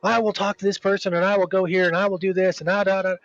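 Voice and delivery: lightly voiced ranting lady; mimicked rant. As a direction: parody her going off. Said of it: "she just started going off".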